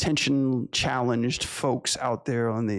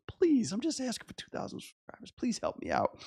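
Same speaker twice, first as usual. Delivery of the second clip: whiny begging; 9 semitones higher, 6 dB quieter than usual. Acted. Begging for subscribers.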